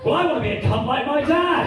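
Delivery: put-on high, loud kid announcement; proud schoolchild. Ten-year-old telling the class his ambition, high and loud. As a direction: pipe up loudly like a child in class.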